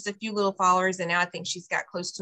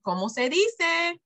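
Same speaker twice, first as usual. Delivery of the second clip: put-on talking to a toddler; 7 semitones higher, slower than usual. Shown as a demonstration. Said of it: "you might say".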